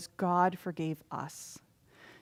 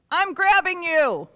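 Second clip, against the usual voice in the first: debtor grabbing; 8 semitones higher, 8 dB louder than usual. Acted. Debtor acted grabbing servant.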